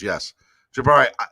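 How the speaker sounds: ordinary talk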